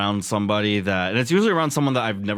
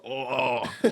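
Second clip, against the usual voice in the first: imitated villainous oh; a hint of cartoon villain; evil person; 3 semitones higher, 4 dB quieter than usual. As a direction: lightly mimic an evil gloat. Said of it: "All evil people make that voice".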